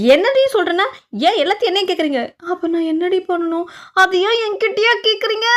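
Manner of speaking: exasperated bickering. Acted. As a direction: toss the blame back in a shrill huff. Two women bicker in panic. (எரிச்சலான வாக்குவாதம்)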